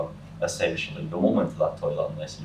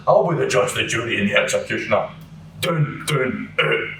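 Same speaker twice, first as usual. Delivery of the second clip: imitating a big man; 3 semitones higher than usual. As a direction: lay down the law like a self-made judge. Huge man breaks up fight.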